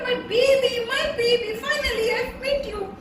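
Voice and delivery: put-on high, joyful mother calling her baby; overjoyed at finding her lost child; high happy greeting; excited reunion cry. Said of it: "I said".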